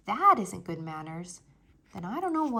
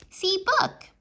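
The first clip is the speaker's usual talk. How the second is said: put-on high, loud baby talk with rising end; a toddler pleading for attention; high little-kid voice; 10 semitones higher, 7 dB louder than usual. Toddler Fudge showing book.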